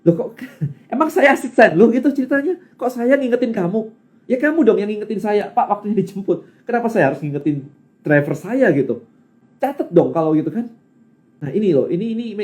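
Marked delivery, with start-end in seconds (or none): 0.0-10.7 high-pitched, indignant mock scolding; retold grumbling. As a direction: gripe in disbelief, pitch raised then easing down. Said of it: "Saya ngomel dong".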